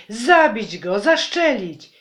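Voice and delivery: high, loud bloodthirsty shouts; loud, high mob cry to kill; mob yelling. Acted. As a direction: shout it like an angry crowd. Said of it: "wrzeszczeli oni".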